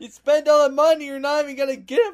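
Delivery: loud, high-pitched scolding; worked-up, exasperated yelling; incredulous outrage. Real, not acted. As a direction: rant in disbelief at a friend's bad purchase. Outraged at month-long delivery.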